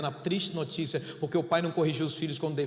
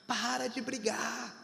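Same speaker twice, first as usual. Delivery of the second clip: put-on slow, soft pleading child voice, a little higher; a son softly begging his father to stop; imitating small son; 4 semitones higher, 9 dB quieter, slower than usual. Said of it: "ele já fica assim".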